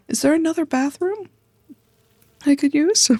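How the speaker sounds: high pleading ask. Acted. Guest begging the cousin for another bathroom, high pleading. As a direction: beg for another toilet.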